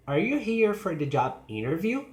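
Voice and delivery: "interview" sweeping upward; yes-or-no question climbing on "interview"; rising question. Shown as a demonstration. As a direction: end "interview" high. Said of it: "rising intonation".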